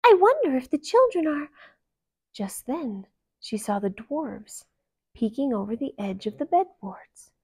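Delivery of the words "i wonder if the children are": put-on higher, light girlish voice; musing aloud, cut off; young princess. A narrator's reading. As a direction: princess wondering to herself.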